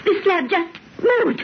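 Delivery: slow, stunned and frightened; slow, awed fear after the slab shifts. Acted. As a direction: say it slowly, still in shock. Frightened after feeling slab move.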